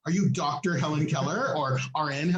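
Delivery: ordinary talk, nothing marked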